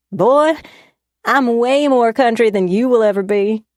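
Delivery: high, loud Southern country twang, teasing. Acted. Woman teasing her boyfriend, in a loud country twang. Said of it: "putting on a country twang".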